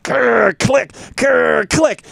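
high growling grr and click noises; mimicked nail clipper. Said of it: "and she's like".

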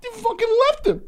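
high, laughing mock sob; imitating sobbing. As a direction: wail at the kid for leaving the dog, half joking. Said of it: "And I was like".

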